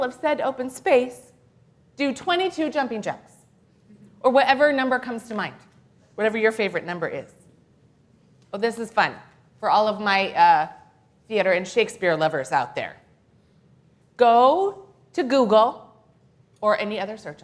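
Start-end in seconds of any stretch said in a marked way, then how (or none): none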